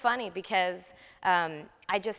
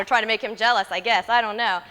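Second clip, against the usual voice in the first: normal talking voice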